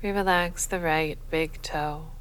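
ordinary talk, nothing marked

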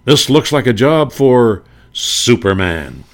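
radio hero proclaiming, slowed; Superman's line. Retold or imitated. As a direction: announce it like the hero stepping up. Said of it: "voice change usually occurred right in the middle".

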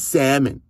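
salmon with a silent L; corrected pronunciation. Retold or imitated. Listener correcting the coach's word, salmon said as SAM-un. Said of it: "you said it wrong".

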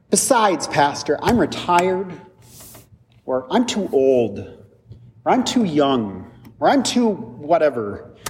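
lightly voiced churchgoer dragging out excuses; excuse-making congregant. Congregant excusing himself to the pastor, mildly high and slow. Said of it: "and you might say".

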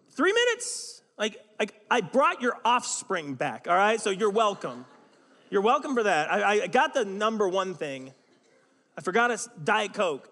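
high, loud sarcastic protest; hammed-up husband huffing at wife; mock indignant argument. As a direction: argue back, self-righteous.

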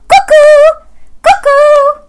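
very high, loud cuckoo; cuckoo call. Acted. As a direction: sing out like a clock bird. Cuckoo clock calling.

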